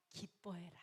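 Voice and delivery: unvoiced secret whisper of God's gift. Telling the congregation God's gift, whisper.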